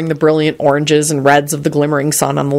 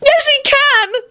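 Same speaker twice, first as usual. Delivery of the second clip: very high, frantic shriek; much slower than usual. A narrator's reading. Elizabeth contradicting the midwife, desperate high shriek. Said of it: "Elizabeth shrieked".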